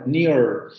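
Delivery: "Near" ending in an r-coloured vowel; rhotic vowel. Shown as a demonstration. Teacher modelling the tricky sound for students, rhotic "Near". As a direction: sound the final R of "Near" fully. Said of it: "la vocal se pronuncia con esa característica".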